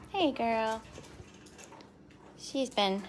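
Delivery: ordinary talk, nothing marked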